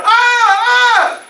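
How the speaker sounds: high, loud cries of a man screaming; drawn-out high wails; acted scream. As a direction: scream out as if attacked. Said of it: "he starts screaming".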